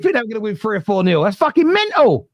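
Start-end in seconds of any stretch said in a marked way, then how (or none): 1.2-2.3 high, drawn-out disbelief; slow it down and pitch it way up, appalled; incredulous outburst. Real, not acted. Host scoffs at rival fans' expectations.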